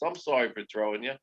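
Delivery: soft, hushed apology to a candy; gently sorry, like soothing a toy; a touch of tender remorse; mock tender apology. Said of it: "she apologized to the Peeps".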